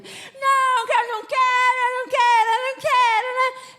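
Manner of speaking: put-on very high, loud childish protest; hammed-up whiny "I don't want to"; mock whiny refusal. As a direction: whine like a kid dodging a spanking. Grown son mock-whining protest.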